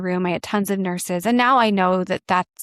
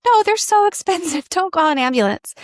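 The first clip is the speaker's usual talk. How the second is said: high-pitched, laughing protest; half-laughing plea, pitched way up; 9 semitones higher, 3 dB louder than usual. Retold or imitated. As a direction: squeak out a laughing objection.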